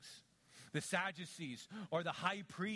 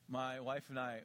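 normal talking voice

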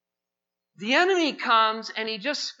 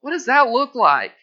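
loud revival preaching; full-force preaching; 4 semitones higher, 9 dB louder than usual. Acted. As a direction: preach it out to the whole room.

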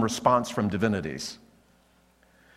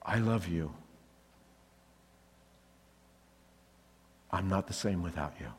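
soft, intimate declaration, much softer; tender; 5 semitones lower, 8 dB quieter than usual. Shown as a demonstration. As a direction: murmur it gently into her eyes. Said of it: "you look longingly into her eyes and you say".

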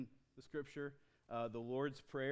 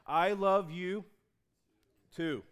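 louder, higher I love you too; loud joyful reply; 5 semitones higher, 10 dB louder, slower than usual. Acted. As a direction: answer back with full joy. The one good answer.

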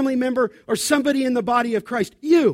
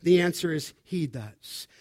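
normal talking voice

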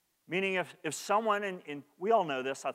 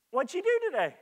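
light, higher, friendly asking; gentle question; 11 semitones higher than usual. Shown as a demonstration. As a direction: ask kindly, with interest. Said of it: "you'll receive one type of answer".

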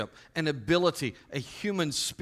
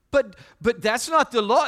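put-on high, loud whiny kid protesting; child's protest; 9 semitones higher, 9 dB louder than usual. Little son objecting to his dad, high and loud. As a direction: squeal it like an indignant little boy.

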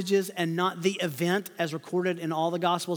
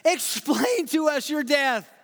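pleading disciples; 7 semitones higher, slower than usual. Pretend. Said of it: "You want them to say".

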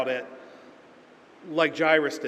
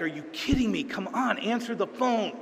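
exasperated, pitched up at the end; irritated; 6 semitones higher than usual. Retold or imitated. Fed up with the cable company, irritated. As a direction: complain at the unanswered phone, half-amused annoyance. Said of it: "I'm getting so irritated and agitated".

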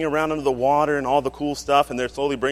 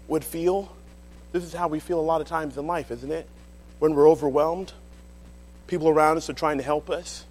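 usual speaking voice

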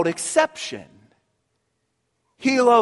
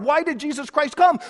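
ordinary delivery

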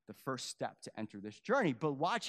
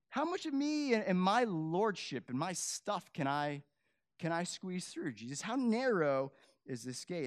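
voiced pleading questioner; 8 semitones higher, 6 dB louder than usual. Voicing a listener pleading with Jesus.

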